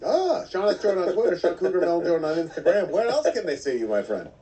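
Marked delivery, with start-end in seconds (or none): none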